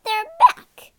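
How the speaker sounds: very high, loud outburst; snarl. A narrator's reading. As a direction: snarl it out, appalled. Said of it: "she snarled".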